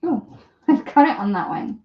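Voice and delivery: ordinary delivery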